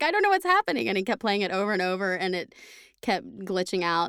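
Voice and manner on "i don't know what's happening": high, loud baffled voice; pitched-up bewildered complaint; confused gamer. Retold or imitated. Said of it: "And he was like".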